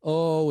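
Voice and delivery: retold pained moan; imitated moan. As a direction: mimic her moaning in pain. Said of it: "then she started moaning".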